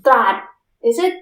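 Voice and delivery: Trat in a Thai accent. Shown as a demonstration. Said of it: "doing the Thai accent".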